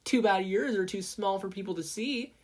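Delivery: high, snide comeback; retort. Acted. Mei retorting to Mina, snidely. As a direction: sneer at the rival's gadgets. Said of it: "Mei retorted".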